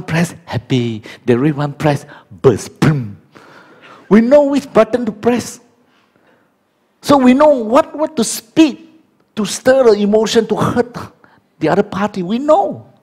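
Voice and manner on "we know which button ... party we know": mock knowing boast. Preacher mocking spouses who know.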